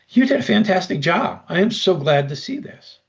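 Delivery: beam like a delighted employer handing out praise; praising boss. Retold or imitated. Imagined employer praising work.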